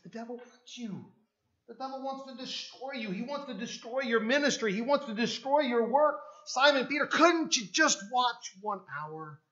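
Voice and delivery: Jesus urging Simon Peter to stay awake; urgent warning. Pretend. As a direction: plead with rising alarm.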